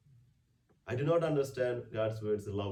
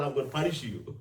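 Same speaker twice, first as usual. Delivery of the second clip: mock angry God; 7 semitones higher, 7 dB louder than usual. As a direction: ham up a stern punishing God. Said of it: "He didn't tell ... No, he didn't say that".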